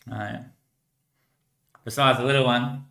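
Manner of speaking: ordinary talk, nothing marked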